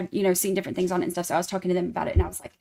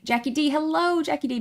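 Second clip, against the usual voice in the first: ordinary talk, nothing marked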